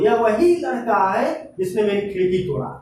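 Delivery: ordinary delivery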